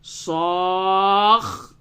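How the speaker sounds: صاخ drawn out very slowly, kha held long; doubled kha. Shown as a demonstration. Teacher models the doubled kha very slowly. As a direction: stretch صاخ and hold the final kha. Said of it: "بہت ہی غور سے سماعت فرمائیں".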